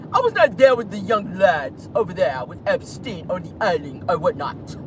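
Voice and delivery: lightly British, evasive; British accent. Retold or imitated. Vlogger mocking Prince Andrew's denial, British accent. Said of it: "can I do it in their accent?".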